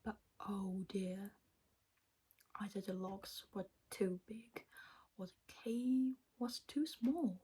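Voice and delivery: ordinary delivery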